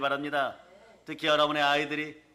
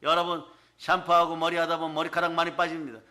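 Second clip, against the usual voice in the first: ordinary talk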